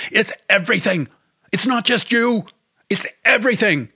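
high, loud anguished yell; screaming. A narrator's reading. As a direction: let it all burst out at her. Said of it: "he screamed at her".

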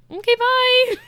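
high, loud, sing-song brush-off; breezy dismissal. Retold or imitated. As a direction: wave her off, hammed-up and high. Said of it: "He's like".

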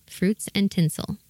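usual speaking voice

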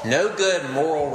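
usual speaking voice

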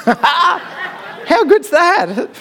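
laughing it out, pitch jumping high; delighted laugh through the words. Real, not acted. Preacher laughing with delight at the punchline.

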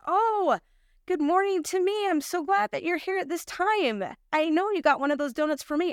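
put-on high, sing-song greeting from an older woman; cheery older clerk.

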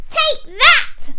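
high, loud villain attack cry; squeaky, triumphant pea yell; shout. A narrator's reading. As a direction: shout it as you blast your enemy. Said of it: "shouted the pea".

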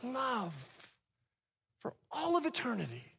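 preacher voicing Jesus longing for eternity, overjoyed; excited Jesus. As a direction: burst with eager joy. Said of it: "He goes".

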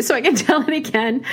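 laughing. Real, not acted. Laughing aside about retelling.

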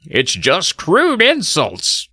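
high, slow put-on voice; slow, affected disapproval; quiet offended neighbour. A narrator's reading. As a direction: draw out the verdict in a high character voice. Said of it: "Mister Miller replied quietly".

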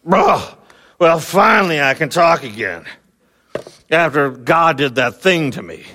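neighbor-style grousing, gently mocked; mock grumbling. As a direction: play him as a sour grumbler, lightly hammed. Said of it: "start grumbling".

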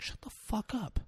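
very soft, high, urgent hushing; mock hushed anger. [name] silences her screaming for the kids, hushed mock anger. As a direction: hush her urgently under your breath.

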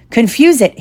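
desperate. Acted. Harry urges his friend to distract the troll, desperate. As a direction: call it out with some desperation. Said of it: "Harry said desperately to Ron".

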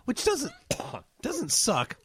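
cough-choked whisper through laughter. Real, not acted. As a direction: sputter out words while coughing. Coughing after mouthful of amaretto.

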